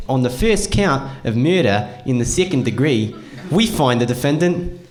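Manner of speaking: thick New Zealand courtroom verdict; Kiwi accent. Acted. TV murder trial retold to the audience, Kiwi accent. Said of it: "that's a pretty spot on Kiwi accent".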